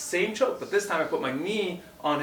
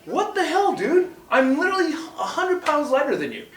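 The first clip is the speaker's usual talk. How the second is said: high, loud retold outrage at the opponent; furious disbelief, pitched way up; angry outburst; 12 semitones higher, 4 dB louder than usual. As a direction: yell it like you just hit your head on the floor. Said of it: "I was angry".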